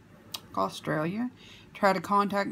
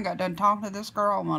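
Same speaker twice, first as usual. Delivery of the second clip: talking through fingers; muffled behind hand; hand over mouth; faster than usual. Real, not acted. Seen 0: Fingers cover her mouth from 0.8 to 2.0 s, not before.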